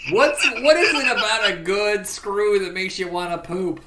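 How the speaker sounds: high, loud mock landlady voice; a lightly done crude landlady; landlady impression. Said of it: "Lin Shaye as the landlady".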